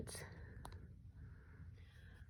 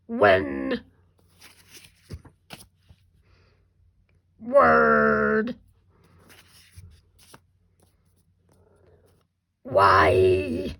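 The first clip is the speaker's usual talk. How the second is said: loud, drawn-out monster growl; 14 semitones lower, 9 dB louder, much slower than usual. Shown as a demonstration. Teacher reviewing sight words with kindergarteners, monster voice. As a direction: growl each word by itself, long and loud. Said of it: "use our monster voice to read them".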